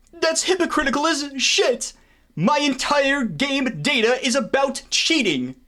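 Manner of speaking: high-pitched outraged rant, slow on the second line; indignant squeal at the hypocrisy. Real, not acted.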